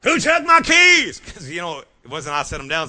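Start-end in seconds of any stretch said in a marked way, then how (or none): 0.0-1.2 slow, high shouted demand; yelling across the room. Retold or imitated. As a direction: yell it through the whole house. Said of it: "You screaming at everybody in the house".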